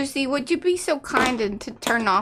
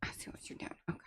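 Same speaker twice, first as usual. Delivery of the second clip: whisper; 22 dB quieter, much faster than usual. Real, not acted. Quiet aside during crafting.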